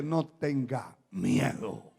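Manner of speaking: low, soft, slow reassurance; calm prophet. Retold or imitated. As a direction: quote the prophet quietly and steadily. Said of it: "el profeta le dice".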